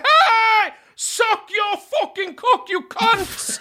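very high, drawn-out heckling roar; yelling out. Acted. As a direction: let loose like an unrestrained wrestling fan. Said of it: "lyst til å rope ut".

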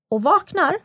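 ordinary talk

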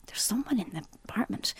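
hushed, urgent whisper; whispered warning. Retold or imitated. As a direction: whisper it so the intruder won't hear. Friend warns of an intruder.

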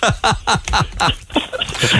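airy laugh; real airy laugh; breathy laugh. Real, not acted. Laughing at joke.

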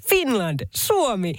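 high, slow sing-song call to guests; imitating a hotel worker. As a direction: hail the Finns like a hotel worker. (hotellityöntekijän matkiva huuto)

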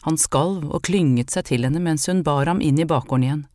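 usual speaking voice

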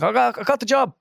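very high, bursting with news; re-enacting giddy good news; retold excited announcement. Announcing new job to workmates.

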